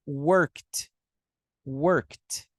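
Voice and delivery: "worked" as one syllable ending in a crisp T; -ed pronounced as T. Shown as a demonstration. Teacher modelling for online students, "worked" ending in a T sound. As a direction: say "worked" like "workt", no extra vowel. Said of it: "você vai pronunciar com T".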